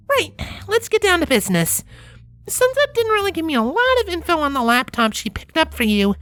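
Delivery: high, businesslike mare voice; high character voice. A narrator's reading.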